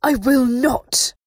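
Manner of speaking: slow, loud, high refusal; furious high voice with a vibrating edge; razor-edged, vibrating. A narrator's reading. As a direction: spit out each word at full force. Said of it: "her voice razor-edged and vibrating".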